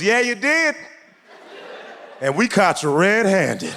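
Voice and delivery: put-on high, cocky kid voice, slow at the end; a little brother busting his sibling; smug eight-year-old. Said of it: "He goes".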